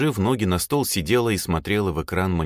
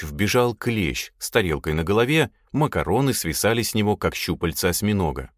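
usual speaking voice

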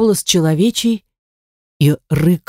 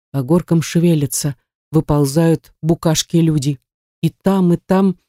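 ordinary delivery, nothing marked

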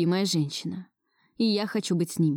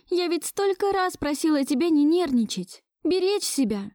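mildly angry, higher-pitched woman; cross scolding; 9 semitones higher than usual. A narrator's reading. Musya scolding Gleb for getting worked up, cross. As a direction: scold him crossly out of concern. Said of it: "когда злилась".

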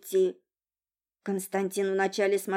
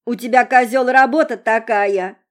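put-on drunk pompous pronouncement; 3 semitones higher, 10 dB louder than usual. A narrator's reading. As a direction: proclaim it like drunk philosophy. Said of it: "глубокомысленно изрекла девица".

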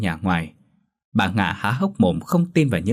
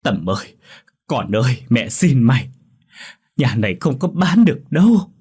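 sobbing plea; 4 semitones higher, 5 dB louder than usual. A narrator's reading. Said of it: "giấm dứt khóc".

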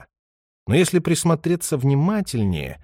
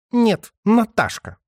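slow, higher, biting retort; snapping; 11 semitones higher, 3 dB louder, slower than usual. A narrator's reading. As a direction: snap back with irritation. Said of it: "огрызнулась Ирина".

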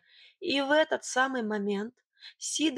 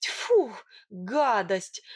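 revolted exclamation; disgusted; much slower than usual. A narrator's reading. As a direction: spit it out in disgust. Disgust at a near kiss.

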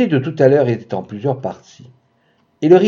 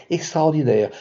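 plain reading voice

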